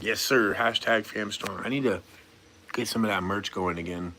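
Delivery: ordinary talk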